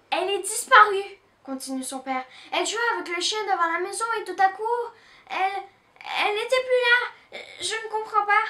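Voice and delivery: frightened dad telling his daughter her sister vanished; alarmed father. Acted. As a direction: blurt it out in distress. Father reports missing daughter.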